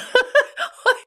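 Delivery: sudden high-pitched burst of laughter; embarrassed high giggle. Real, not acted. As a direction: let a squeaky laugh escape. Laughs at own cluelessness.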